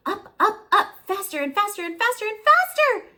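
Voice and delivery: high, loud and fast, accelerating; triumphant rush to the top; building excitement. A narrator's reading. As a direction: speed up with the engine. Climax of climb.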